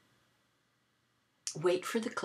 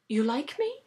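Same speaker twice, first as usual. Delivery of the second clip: higher, louder incredulous question; taken aback; 7 semitones higher, 6 dB louder than usual. Retold or imitated. Phone caller reacting to a compliment, taken aback. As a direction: ask it in mock surprise, pitched up.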